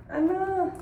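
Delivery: sharp high ah non; frustrated exclamation; dismayed cry. Real, not acted. Struggling to open package.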